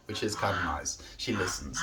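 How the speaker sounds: whispered aside; soft whispered aside. Real, not acted. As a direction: hush it under the breath.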